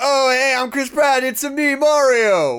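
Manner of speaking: high, loud mock movie-star greeting; hammed-up actor announcing himself as Mario; Chris Pratt. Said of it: "Or he could be like".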